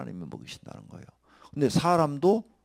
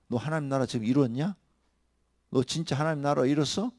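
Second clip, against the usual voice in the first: usual speaking voice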